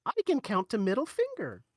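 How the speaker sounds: put-on high, innocently proud kid voice; little child. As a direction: show off to Mommy like a small child. Plays child answering mother.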